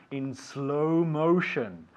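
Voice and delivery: very slow, stretched syllables; slow motion. Shown as a demonstration. Teacher recaps the word list, in slow motion. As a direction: draw it right out to half speed. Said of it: "I will say things in slow motion".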